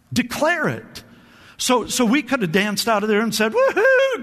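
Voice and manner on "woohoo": high falsetto whoop of joy; joyful whoop. Pretend. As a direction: whoop it up, dancing. Imagined celebration.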